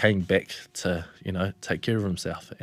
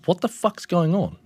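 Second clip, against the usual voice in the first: mildly alarmed, higher-pitched retold question; alarmed demand; 9 semitones higher than usual. Partner asks what is happening, retold alarmed demand. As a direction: raise the pitch in confused alarm.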